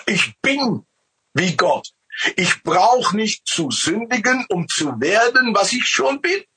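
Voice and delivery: high, emphatic retort; defiant Adam. Retold or imitated. Adam telling the serpent off, high and emphatic. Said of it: "hatte ich gesagt".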